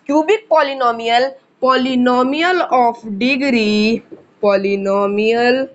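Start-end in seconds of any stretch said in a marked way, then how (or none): 1.6-5.7 very slow, stretched syllables with pauses; slow dictation. Real, not acted. Teacher dictating the cubic definition to students, slow dictation. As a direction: draw each word out at writing pace.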